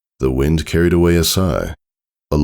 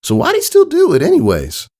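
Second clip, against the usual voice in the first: much higher, louder curious filly voice; Applebloom asking; 4 dB louder than usual. Acted. Applebloom pressing Scootaloo about flying, high curious filly voice. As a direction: ask it like an eager young filly. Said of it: "Applebloom asked".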